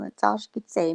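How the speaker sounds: ordinary talk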